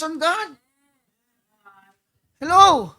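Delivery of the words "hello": loud hello. Real, not acted. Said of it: "calling congregation's attention".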